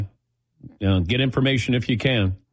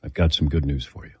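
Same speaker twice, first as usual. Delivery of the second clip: doctor's low hush; 7 semitones lower than usual. Retold or imitated. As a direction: mimic the doctor's hushed murmur. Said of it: "And he talked like this".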